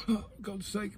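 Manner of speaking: high, faint and strained after coughing; choking. Real, not acted. Exasperated vlogger mid coughing fit, choking. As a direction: squeeze it out between coughs. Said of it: "Oh, my God, I'm choking".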